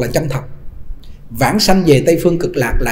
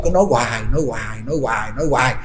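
fading repetition; 6 semitones lower, 7 dB quieter, faster than usual. Retold or imitated. Repetitive nagging.

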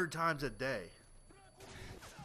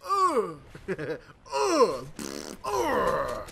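mock pulsing grunts; 6 dB louder, much slower than usual. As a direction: fake the throbbing with short grunts. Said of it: "it's just like".

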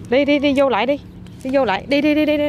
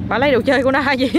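ordinary talk

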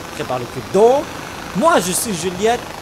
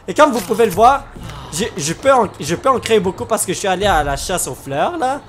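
usual speaking voice

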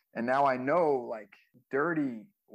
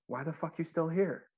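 higher-pitched deadpan challenge; imitating Dirty; 7 semitones higher than usual. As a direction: quote a straight-faced challenge in a raised pitch. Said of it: "with a straight face and he goes".

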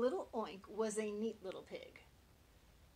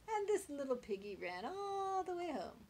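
high squeaky piggy voice, gliding home; 9 semitones higher, 3 dB louder than usual. Acted. Mother reading the toe rhyme to Little Oink, high squeaky piggy voice. Last rhyme line, wee wee voice.

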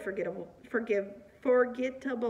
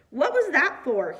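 aghast, higher and louder; a raised, appalled demand for an explanation; 4 semitones higher, 6 dB louder than usual. A narrator's reading. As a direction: demand it in affronted shock. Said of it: "he says, aghast".

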